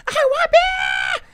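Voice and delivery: very high, slow, shrieking demand; tantrum shout. Retold or imitated. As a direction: scream it like a spoiled toddler. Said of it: "she's going".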